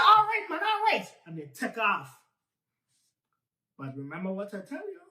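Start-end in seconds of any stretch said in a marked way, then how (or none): 0.0-1.1 high giving in. Acted. Nancy giving in.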